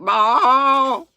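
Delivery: loud, high calf bellow; cow noise. A narrator's reading. Second runaway calf answering, loud high bawl. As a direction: bawl the word like livestock. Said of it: "I'm pronouncing it like it says".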